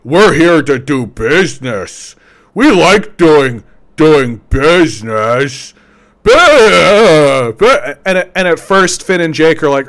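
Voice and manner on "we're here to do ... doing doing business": slow, odd stiff businessman drone; slowed, deadpan corporate drone; business guys voice. Retold or imitated. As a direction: talk like a robotic suit thawed from a glacier. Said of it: "they talk like this".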